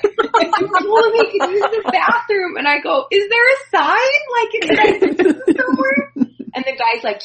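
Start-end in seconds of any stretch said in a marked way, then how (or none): 0.0-2.6 laughing quote. Retold or imitated. Retelling staff member's complaint. 3.1-6.1 incredulous question. Retold or imitated. Retold own incredulous question.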